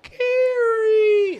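high wailing cry of a name; imitating a crying friend. Retelling Jordan crying for Gary, high wail. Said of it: "He was like".

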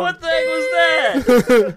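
high, loud shout. Real, not acted. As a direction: demand an answer in disbelief. Co-host's slip on mic.

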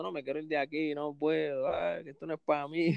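lightly voiced homesick sob; retold crying plea. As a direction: mildly re-enact begging dad to go home. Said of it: "yo llorando".

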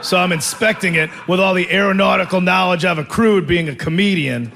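usual speaking voice

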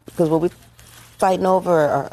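ordinary talk

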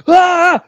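high, loud yell; short acted roar at someone; mock enraged scream. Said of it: "I'm, like, screaming at this kid".

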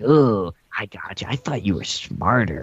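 a hint of a smug cartoon villain; gloating villain. Villain taunting the hero, a lightly mocked gloat. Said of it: "going off on this".

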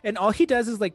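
normal talking voice

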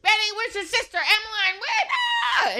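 put-on high, frantic calling to her kids; hollering after the kids in a crowded hallway; mock scream. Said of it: "and I'm screaming".

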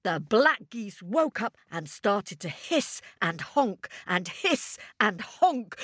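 usual speaking voice

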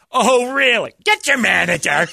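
high, loud indignant customer demand; snooty customer. Retold or imitated. Waiter mimics the rude customer, loud and indignant.

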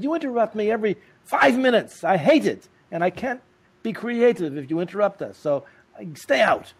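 high, loud exasperated scolding; irritated husband. Retold or imitated. Tony scolding his wife for interrupting, loud and high. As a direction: snap at her to stay out.

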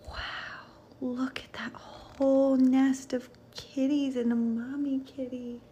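hushed, breathy wonder; awed whisper. A narrator's reading. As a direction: whisper so as not to startle them. Revealing the nest of kittens.

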